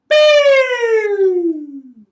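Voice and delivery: long, loud, high sung boop; sung high note for the kids; high held boop. Shown as a demonstration. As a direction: slide way up and hold it. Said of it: "As our voice goes up".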